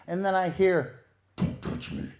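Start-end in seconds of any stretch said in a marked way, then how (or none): 1.4-2.1 unvoiced low demonic whisper. Woman in bed warning him off, demonic whisper. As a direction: rasp it out like something possessed. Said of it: "Did I just hear a demonic voice".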